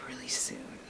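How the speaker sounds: a hushed whispered aside. Real, not acted. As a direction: whisper it like a secret. Whispered aside about license.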